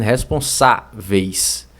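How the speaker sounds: slow "Responsáveis", SA and VEIS set apart; syllable split. Shown as a demonstration. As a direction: break "Responsáveis" after a firm SA. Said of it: "Essa aqui é a sílaba tônica". (separando as sílabas devagar)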